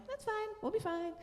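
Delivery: high, airy retold reassurance; mock-carefree singsong; breezy reassurance. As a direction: brush away the worry in a high voice. Retells reassuring impatient husband.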